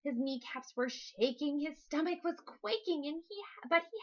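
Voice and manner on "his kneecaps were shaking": usual speaking voice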